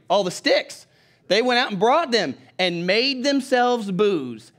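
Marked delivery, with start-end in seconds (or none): 1.3-4.5 loud, slow, raised preaching voice; forceful scripture reading. Acted. Preacher reading the verse to his church, loud and emphatic. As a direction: proclaim the verse slowly and emphatically.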